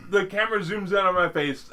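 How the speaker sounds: high, loud dramatic cue; dramatic announcement. Pretend. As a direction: play it like a movie climax. Dramatic flashback moment.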